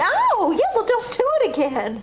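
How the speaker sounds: high-pitched yelp into a scolding; yelp, then tell them off; sore, indignant complaint; pained protest. Acted. Hurt again, scolds the therapist.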